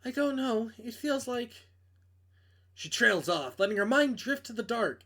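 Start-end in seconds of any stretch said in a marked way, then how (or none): 0.0-1.7 Maeve, lightly voiced, a touch higher; a hint of a nervous young woman; girl voice. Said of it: "I can't do girl voices".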